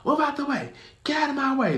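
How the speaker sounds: very high, mock "move out the way"; officer barking. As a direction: shove past the crowd. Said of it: "'cause he just".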